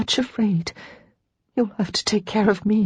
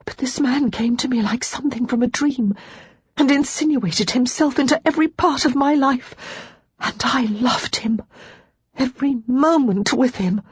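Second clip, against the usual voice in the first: tearful; 7 semitones higher than usual. Acted. Lara sobbing confession.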